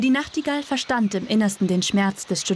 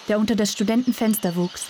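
ordinary delivery

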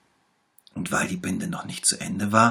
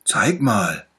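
lightly voiced little bear, louder; 4 semitones higher, 9 dB louder than usual. A narrator's reading. Little bear promises to heal the tiger, louder. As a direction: promise it brightly like a small doctor. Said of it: "fragte der kleine Bär".

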